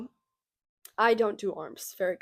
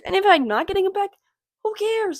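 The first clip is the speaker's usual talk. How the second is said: carefree high voice; 11 semitones higher, 5 dB louder than usual. Real, not acted. Carefree rhetorical outburst.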